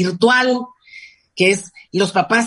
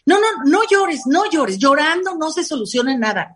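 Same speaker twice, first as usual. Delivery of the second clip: mock scolding parent; 7 semitones higher than usual. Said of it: "no permiten, por ejemplo, el llanto".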